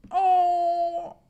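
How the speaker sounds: a high crying "oh"; high tearful sob. Real, not acted. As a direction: let a sob break out. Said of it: "I'm actually crying".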